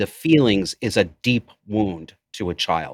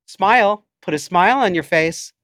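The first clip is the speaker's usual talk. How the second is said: higher, louder barked order; mother's command; 10 semitones higher, 4 dB louder than usual. Retold or imitated. Imitating her mother ordering her to smile. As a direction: snap it like a controlling mother. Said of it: "she'd force me to smile".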